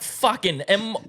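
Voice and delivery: high, incredulous mock outburst; exasperated rant. Worker to people who keep mishearing his name, exasperated rant.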